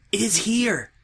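high, eager zealot voice; eager Twilight. A narrator's reading. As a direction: point it out with hushed pride.

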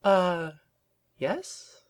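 high, uncertain questioning answer; tentative venturing. A narrator's reading. Rarity answers Twilight's rant, tentative and high. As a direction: offer it timidly, rising in pitch. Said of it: "she ventured".

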